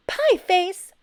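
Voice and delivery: high, loud sputtered insult; shocked loud insult; sputtering. A narrator's reading. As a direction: sputter it back. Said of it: "Abel sputtered".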